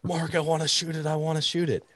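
high, pleading whine; high, pleading retold begging; desperate plea. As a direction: beg like an excited kid holding a gun. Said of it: "shaking with my shotgun".

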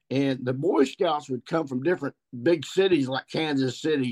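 ordinary talk, nothing marked

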